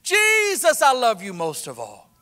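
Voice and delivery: high, loud retold cry of worship; loud praise. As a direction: cry out Jesus' name in joy. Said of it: "just as loud as she said".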